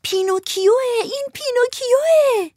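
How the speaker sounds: high, excited puppet shout; a crowd of puppets cheering. Acted. All the puppets shout Pinocchio's name, high and excited. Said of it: "فریاد کشیدند".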